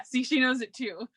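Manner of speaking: usual speaking voice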